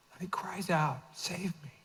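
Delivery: breathy whispered cry for help; whispered plea. Acted. Peter sinking, his plea whispered to Jesus. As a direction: hush it to a whisper.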